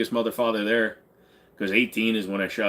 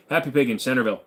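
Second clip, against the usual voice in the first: normal talking voice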